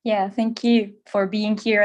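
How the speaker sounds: ordinary talk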